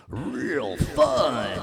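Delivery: high, drawn-out showy flourish; playful swooping exclamation; hammed-up excitement. Hammed-up word while demoing delay effect.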